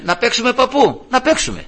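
high-pitched imitated child; eager child. Grandson agreeing to play with grandfather, eager imitated child.

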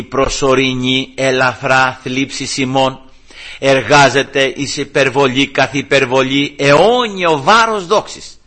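slow, measured, emphatic recitation; chant-like delivery, building to the final words; scripture recitation. Real, not acted. Preacher quotes the Gospel verse to congregation, scripture recitation.

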